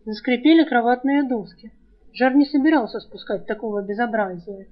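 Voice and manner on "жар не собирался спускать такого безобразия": plain reading voice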